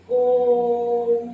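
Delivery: ओम held three times as long, loud and full; long sung-out ओम as in a mantra; drawn-out Om. Shown as a demonstration. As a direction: stretch ओम like a chant. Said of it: "तो इसको बोलने में तीन गुना समय लगता है".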